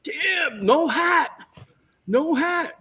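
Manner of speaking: very high, strained mock shout; frustrated director. Director objecting to the actor's hat, high mock frustration. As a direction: imitate a frustrated director calling out, high-pitched. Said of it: "he's all frustrated".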